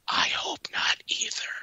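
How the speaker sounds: soft, breathy whisper; ghostly whisper. Retold or imitated. Ghost voice on the recording, whispered softly. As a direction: breathe it like an unseen voice on tape. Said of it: "a third voice that starts laughing and says".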